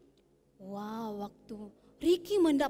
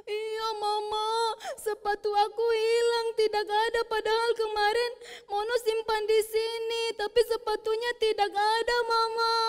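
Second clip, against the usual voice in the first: put-on whiny child; 8 semitones higher, 4 dB louder than usual. Mono complains tearfully to mother.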